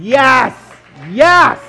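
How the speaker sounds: loud, high triumphant cheer; excited shout. Real, not acted. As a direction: shout it like a victory. Cheering the last volunteer.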